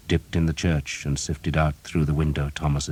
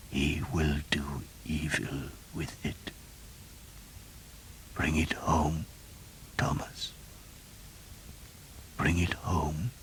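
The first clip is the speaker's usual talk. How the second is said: very soft, slow dying whisper; 12 dB quieter, slower than usual. A narrator's reading. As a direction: plead in a faint whisper. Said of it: "He spoke softly".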